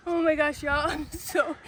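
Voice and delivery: giggling nonstop while talking; laughing. Real, not acted. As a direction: laugh helplessly through the words. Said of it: "she just starts laughing nonstop".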